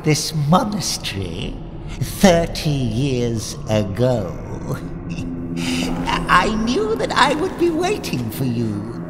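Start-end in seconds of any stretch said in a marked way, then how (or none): 4.4-6.2 low, breathy evil chuckle. Acted. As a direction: chuckle darkly to yourself. Villain bishop gloats.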